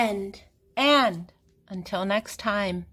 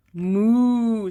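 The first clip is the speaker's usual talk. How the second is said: loud, long, swelling moo; cow moo; 8 dB louder than usual. A narrator's reading. Cow revealed under the queen costume, long loud moo. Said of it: "says the cow".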